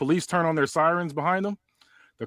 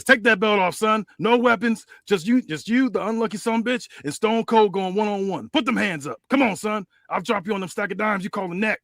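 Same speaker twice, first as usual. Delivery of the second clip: Stone Cold taunt; 6 semitones higher than usual. Acted. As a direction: dare him to fight. Stone Cold calls out cop.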